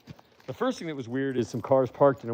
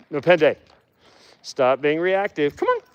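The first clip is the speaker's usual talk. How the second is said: raised, louder scolding of a dog; firm dog command; 5 semitones higher, 4 dB louder than usual. Real, not acted. Owner telling a reactive dog to stop, firm command. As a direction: rein the dog in firmly.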